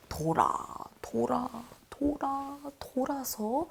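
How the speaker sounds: circle round softly, step by step; winding repetition. Shown as a demonstration. Acting out winding roundabout route. (점점 높아지며 작고 길게 돌고 도는 느낌)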